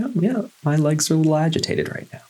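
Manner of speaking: high, quick, easy acknowledgment; accepting self-talk. Pretend. Meditation guide modelling what to tell yourself, accepting self-talk. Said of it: "Just say".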